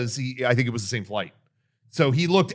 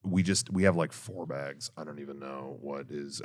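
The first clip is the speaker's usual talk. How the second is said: low, much softer, deflated voice; low-energy voice; 11 semitones lower, 11 dB quieter than usual. Shown as a demonstration. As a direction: let all the energy drain out of the voice. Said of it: "the register just gets lower and lower".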